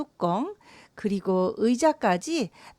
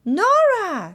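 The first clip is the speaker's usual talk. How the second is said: high-pitched, exasperated name call; sister's yell; 7 semitones higher, 4 dB louder than usual. A narrator's reading. Big sister yelling at Nora, high cry. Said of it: "언니가 소리를 쳤습니다".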